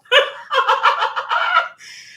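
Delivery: squealing laugh, rising; high shrieking laugh. Real, not acted. Mask sagging, shrieks.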